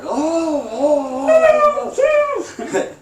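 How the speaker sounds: very high, loud cries of pain; hollering in pain. Retold or imitated. Beaten inmate hollering in pain on the cell floor, loud wails. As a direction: wail it like you're being stomped. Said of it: "And I'm hollering".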